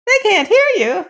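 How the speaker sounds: put-on very high, loud old woman; high-pitched, astonished old-woman cry; old mother crying out. A narrator's reading. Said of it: "cried his mother".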